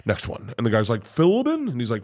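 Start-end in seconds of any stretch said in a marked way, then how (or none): 1.0-1.7 much higher, hopeful "Philbin?"; eager question. Retold or imitated. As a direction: test him with a book title. Clerk naming a sci-fi book.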